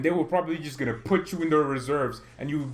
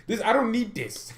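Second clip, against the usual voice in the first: irritated; 8 semitones higher than usual. Real, not acted. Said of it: "I'm so irritated today".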